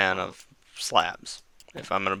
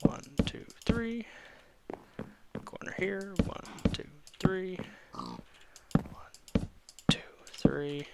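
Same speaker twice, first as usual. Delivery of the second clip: whispered counting; 9 semitones higher, 7 dB quieter than usual. Real, not acted. Counting while placing blocks.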